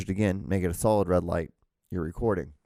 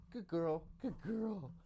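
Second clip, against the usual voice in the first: much higher, softer voice for the dog; pet talk; 11 semitones higher, 13 dB quieter, slower than usual. Real, not acted. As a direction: coo praise at the dog.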